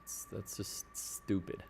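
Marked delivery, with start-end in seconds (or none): none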